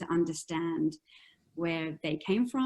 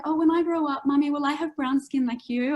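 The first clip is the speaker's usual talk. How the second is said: put-on higher-pitched child asking mum; little kid's question, raised pitch; imitating little daughter; 7 semitones higher, 4 dB louder than usual. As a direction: lightly voice a hopeful small girl. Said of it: "she does say things to me like".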